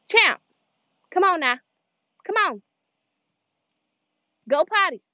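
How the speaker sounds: high, loud dog commands; calling the dog. Real, not acted. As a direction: call out firmly to a dog. Calling and ordering the dog outside.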